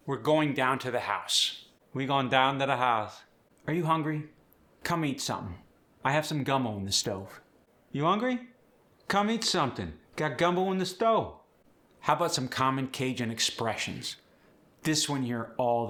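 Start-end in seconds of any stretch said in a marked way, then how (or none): 1.9-3.2 Cajun accent, "We going" with the verb dropped; easy Cajun, gon' down to the house. Shown as a demonstration. Coach showing Cajun grammar and accent. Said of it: "with the Cajun accent". 7.9-11.4 higher, sing-song Cajun welcome; Cajun host. Shown as a demonstration. Host inviting guest to eat, high Cajun lilt. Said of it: "with the Cajun accent".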